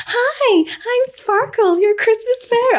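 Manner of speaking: sing-song Christmas fairy greeting; Sparkle fairy voice. Retold or imitated. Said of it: "Do the voice".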